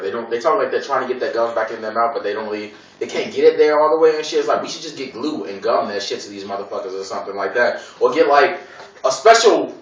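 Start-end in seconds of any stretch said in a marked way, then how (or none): none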